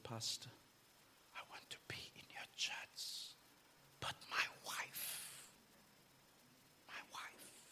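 hushed whisper, fading to almost nothing; whispered plea. Retold or imitated. Retelling a weeping brother's plea to the pastor, soft whisper. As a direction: breathe the brother's broken plea.

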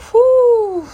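high, loud blown-out whew; sharp rise into a long "whoo"; exasperated whew. Real, not acted. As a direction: let off steam in a long whew. Exasperated exhale after the rant.

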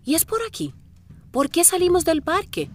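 high, alarmed questions; suddenly lost and alarmed; confused surprise. Real, not acted. Confundida por la ruta. (sorpresa confundida)

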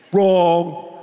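loud, drawn-out interjection; Trump imitation. Preacher rejects the crowd's answer, loud mimicking interjection. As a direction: cut in loudly and hold the word. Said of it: "That's my Trump imitation".

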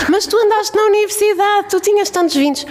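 very high-pitched wailing grandmother; imitated tearful protest. Grandmother insisting to her granddaughter she did well, mock tearful protest. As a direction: protest in a high, hammed-up whine. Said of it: "E a minha avó chorava".

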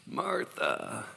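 soft, slow and tender, fading down; gentle, tender rebuke. Acted. Jesus gently corrects busy Martha, soft and tender. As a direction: lovingly correct an anxious friend. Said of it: "It's kinda like".

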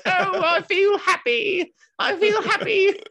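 hammed-up frail old man, cheerful squeak; high feeble old man. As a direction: chirp it like a frail old man pretending to be well. Dad voiced in Python sketch.

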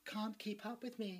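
slow, softened character voice; put-on other voice. Actor showing rival another voice, slow and soft. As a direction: show off a hushed, drawn-out voice. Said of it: "There's my other voice".

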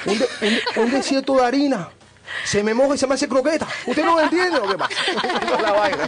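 put-on Cuban accent. Guide defending himself to the hosts, rising Cuban-accent rant. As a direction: ham up a flustered Cuban guide pleading to be understood, Cuban accent. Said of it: "no supera el acento cubano".

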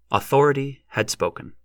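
usual speaking voice

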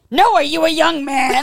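put-on loud, high incredulous old man; hammed-up disbelieving question. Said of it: "And he went".

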